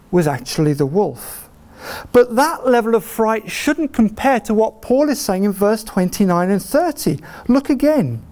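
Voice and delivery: ordinary talk, nothing marked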